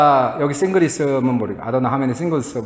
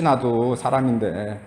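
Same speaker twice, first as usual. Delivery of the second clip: ordinary delivery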